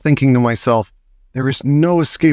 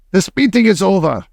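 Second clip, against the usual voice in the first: witch shout; 7 semitones higher than usual. A narrator's reading. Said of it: "she shouted".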